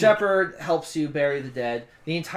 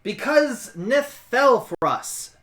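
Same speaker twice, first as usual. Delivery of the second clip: slow, loud, emphatic answer; exasperated explanation; 8 semitones higher, 5 dB louder, slower than usual. Acted. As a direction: spell it out high and loud.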